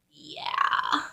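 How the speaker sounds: very soft, almost voiceless; small, guilty reply; meek admission. Retold or imitated. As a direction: admit it sheepishly under your breath.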